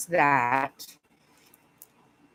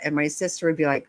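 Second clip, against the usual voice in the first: usual speaking voice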